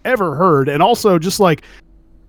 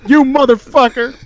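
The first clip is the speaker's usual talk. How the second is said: laughing; 7 semitones higher, 4 dB louder than usual. Real, not acted. Laughing, cursing a friend.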